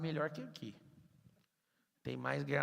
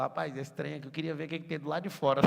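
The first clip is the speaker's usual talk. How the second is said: fast, louder folksy grumble; Adam's mock complaint; 2 semitones higher, 8 dB louder, faster than usual. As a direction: rush out Adam's restless wish.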